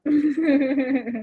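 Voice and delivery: short laugh. Real, not acted. Buyer laughing that she had some help. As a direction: laugh at your own confidence.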